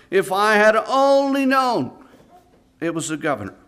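condemned man's final cry before hanging; anguished last words. Acted. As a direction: stretch out only in despair. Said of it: "the last words the young man uttered".

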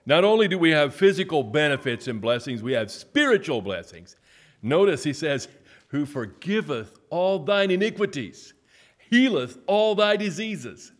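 high, loud proclaiming; preaching to the church about spiritual blessings, high and loud. Acted. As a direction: preach it with rising fervor. Preaching at full force.

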